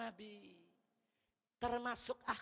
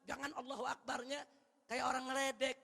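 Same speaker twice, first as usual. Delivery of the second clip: ordinary delivery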